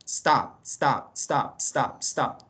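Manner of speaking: "Stop" repeated as separate single beats; one syllable. Shown as a demonstration. Teacher proving the verb has one syllable, "stop" repeated in single beats. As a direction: say each "stop" as one short beat, starting straight on S. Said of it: "Tiene una sola sílaba".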